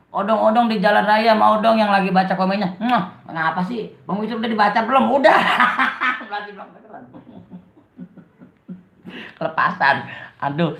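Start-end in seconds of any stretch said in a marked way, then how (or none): 5.4-6.3 high cackling laugh; burst of laughter. Real, not acted. Streamer answers a viewer about comments, bursting into laughter.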